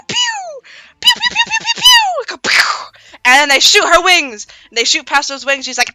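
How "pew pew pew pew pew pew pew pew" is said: very high pew pew; gunshot sounds. Retold or imitated. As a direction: fire off laser zaps. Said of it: "And they, pew".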